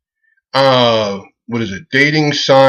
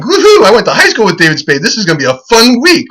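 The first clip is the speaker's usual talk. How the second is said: excited; 7 semitones higher than usual. Retold or imitated. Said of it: "And I'm like".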